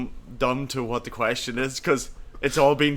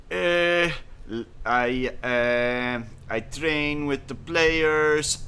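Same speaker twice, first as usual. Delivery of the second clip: slow, halting mock Dutch accent; halting, put-on Dutch accent for a bad interview; 4 semitones higher, 3 dB louder, much slower than usual. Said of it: "sounded like Steve McLaren".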